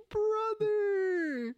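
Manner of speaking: high, fake-crying brother; mock despair. Player retelling wasted prep with a high mock cry. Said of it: "I was like".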